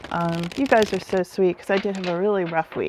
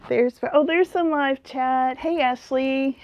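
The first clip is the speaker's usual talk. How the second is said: ordinary talk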